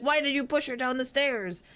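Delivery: high, loud mock accusation; family scolding the wrong daughter; accusing family. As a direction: demand an answer, outraged. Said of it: "they're like".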